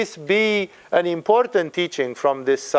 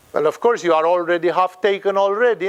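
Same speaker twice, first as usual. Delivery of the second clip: ordinary delivery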